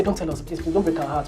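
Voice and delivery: soft pleading. Retold or imitated. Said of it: "they'll say".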